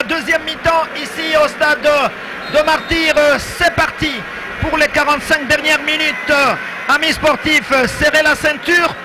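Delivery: lively radio play-by-play; sports commentary. Real, not acted. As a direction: call the match like a radio commentator. Live match commentary begins.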